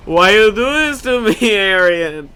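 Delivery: hammed-up lament to the sky; anguished cry. Real, not acted. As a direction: cry out in mock despair. Mock despair at game.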